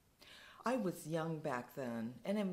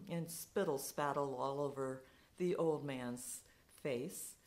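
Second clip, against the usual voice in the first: plain reading voice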